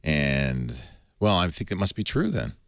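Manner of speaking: ordinary delivery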